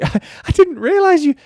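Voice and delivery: high, loud, laughing through the words; giggling sheepish sorry; laughing apology. Retold or imitated. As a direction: laugh while retelling the apology. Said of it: "And I said".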